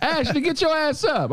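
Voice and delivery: high, stern wake-up call; playful disciplinarian wake-up; mock strict parent. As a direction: bark her awake like a strict parent.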